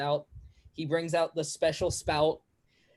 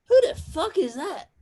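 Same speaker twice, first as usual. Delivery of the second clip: put-on high, louder bewildered drunk; high confused patron; 12 semitones higher, 4 dB louder than usual. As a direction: ask it like a baffled drunk. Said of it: "the other one goes".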